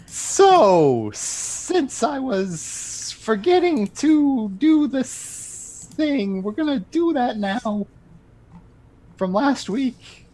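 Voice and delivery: very high voice, drawing out the S in "So", "since" and "this"; slow, hammed-up delivery with long hissed S's on "So", "since", "this"; extended S sounds. Game master making up for forgotten S's, stretching each S in "since" and "this". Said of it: "you're just gonna extend the S's".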